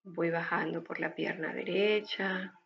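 calm breathing guide, unhurried and gentle; guided meditation. Acted. (voz de meditación guiada)

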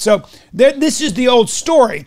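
usual speaking voice

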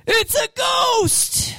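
panicked disciple yelling slowly; terrified shout. Retold or imitated. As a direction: shriek it like you've seen a ghost. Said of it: "the guy saying".

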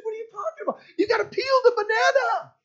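high voice, held up all through; high mock puzzlement. Pastor voicing the kids' exchange.

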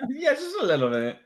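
high, loud giggly agreement; laughing high echo. Real, not acted. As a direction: squeal it through a laugh. Laughing echo of cancer joke.

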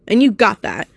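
ordinary talk

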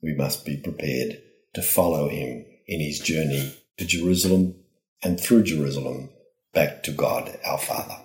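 ordinary delivery

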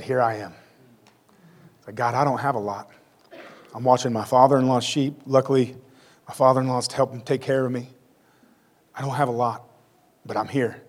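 soft, humble plea; lightly voiced Moses, quiet and modest.

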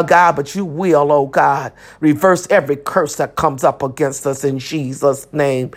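usual speaking voice

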